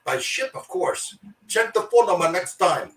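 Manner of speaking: high-pitched put-on Chinese accent; Shanghai accent. Shanghai office man correcting a caller, high Chinese accent. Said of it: "our head office in Shanghai".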